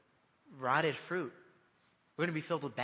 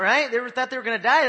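usual speaking voice

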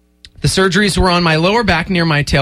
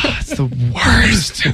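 slow Batman voice with marbles in mouth; 4 semitones higher, slower than usual. Acted. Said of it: "He's got marbles in his mouth".

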